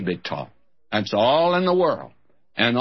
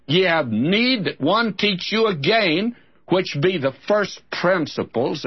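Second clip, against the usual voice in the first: higher, measured Bible reading; scripture reading; 5 semitones higher than usual. Acted. Preacher reading Hebrews to his listeners.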